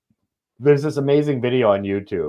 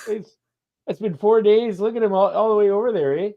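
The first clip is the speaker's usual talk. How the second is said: very high mock Canadian voice; squeaky Canadian lament for the dog; Canadian accent; 12 semitones higher, 4 dB louder than usual. Mocking the man whose dog ran off, high Canadian accent.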